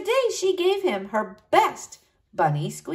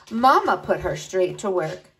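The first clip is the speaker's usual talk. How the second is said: plain reading voice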